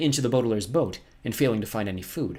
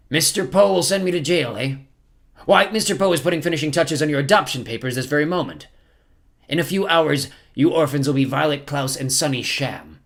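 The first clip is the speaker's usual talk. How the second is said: higher, louder villain voice; gloating villain captain; 6 semitones higher, 7 dB louder than usual. Acted. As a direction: gloat over a beaten victim, loud.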